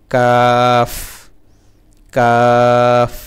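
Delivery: কাফ loud, ending with a light f touch; Arabic kaf. Shown as a demonstration. Teacher demonstrating kaf, loud. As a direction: say কাফ with lips and teeth closing at the end. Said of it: "শেষে ফার মতো কিছুটা".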